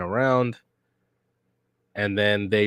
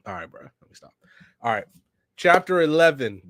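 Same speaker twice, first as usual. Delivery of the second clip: usual speaking voice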